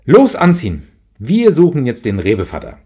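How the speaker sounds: loud and high, rallying cry; mother rousing her kids to go searching, loud and eager; sudden excited call. Acted. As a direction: shout it like a spontaneous adventure. Mother rallies children suddenly.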